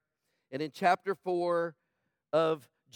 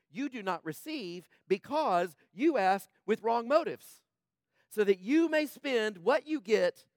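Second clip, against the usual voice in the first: forceful scripture reading; 5 semitones higher, 6 dB louder, slower than usual. Acted.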